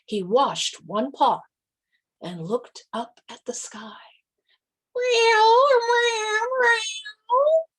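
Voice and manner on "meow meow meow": loud, high meows; cat showing how to crow, meowing loud. A narrator's reading. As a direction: yowl it up to the sky. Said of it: "I'll show you".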